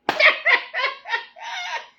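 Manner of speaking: sudden high mocking laugh; high burst of laughter. Real, not acted. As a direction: laugh out high and loud at the absurdity. Mocking the welfare refusal.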